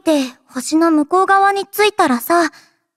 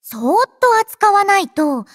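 normal talking voice

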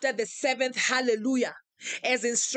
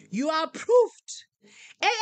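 preaching declaration; 6 semitones higher, 4 dB louder than usual. Acted. Preacher declaring members approved, full-force preaching. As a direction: proclaim each name like a blessing from the pulpit.

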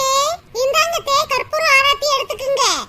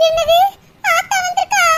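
normal talking voice

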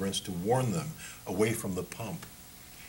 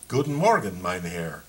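crisp military German; 5 dB louder than usual. Acted. Paul to the German guard, crisp military German. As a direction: snap it out like a soldier to an officer. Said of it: "his crisp military German".

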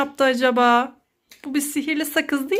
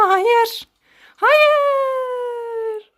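high, drawn-out cry of no; mock pleading no; 9 semitones higher than usual. Mother begs her daughter not to swallow all the gum, high drawn-out mock no. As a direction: wail it to stop her.

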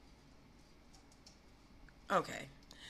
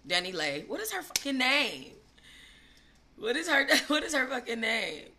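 ordinary talk

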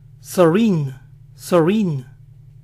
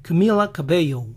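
American accent. Shown as a demonstration. Showing how Americans say Camila Cabello, American accent. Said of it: "cómo sería entonces [name]".

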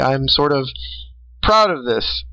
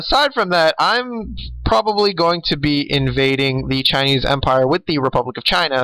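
ordinary talk

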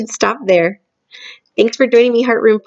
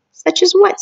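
put-on Sophie, curious girl; 10 semitones higher than usual. Sophie eagerly questions the giant.

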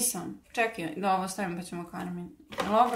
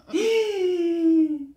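loud, high laughing gasp; shocked gasp; 7 semitones higher, 7 dB louder than usual. Real, not acted. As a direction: gasp at the sight. Sees the finished makeup.

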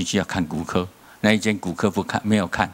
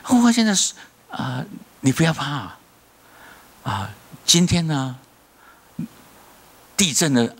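preach; 4 semitones higher than usual. Acted. Preaching reassurance to congregation.

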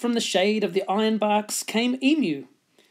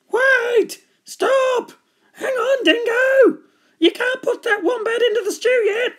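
very high squeaky shouting; frantic animals; 6 dB louder than usual. A narrator's reading. Animals saving Wombat from the stew, frantic. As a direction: cry out urgently in a high animal voice.

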